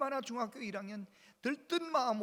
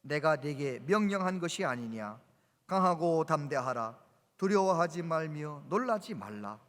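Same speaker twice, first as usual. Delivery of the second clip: scripture reading; 6 dB louder than usual. Acted. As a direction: proclaim the verse to the congregation. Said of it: "한번 읽어볼까요? 시작".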